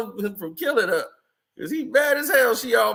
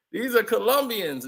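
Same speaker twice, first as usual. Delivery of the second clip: ordinary talk, nothing marked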